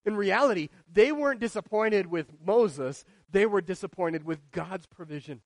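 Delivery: ordinary delivery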